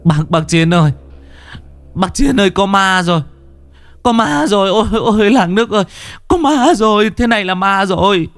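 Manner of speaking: high panicked cries of ghost; terrified screaming. Acted. Villagers crying out to Chiến after seeing the corpse, terrified high cries. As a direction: scream for help in terror, high. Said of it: "kinh hãi mà gào thét".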